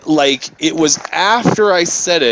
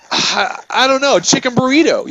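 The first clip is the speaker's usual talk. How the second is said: retold flustered answer; 10 semitones higher than usual. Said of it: "And I go".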